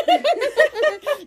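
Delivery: real high laugh; high burst of laughter. Real, not acted. Laughing at her own story.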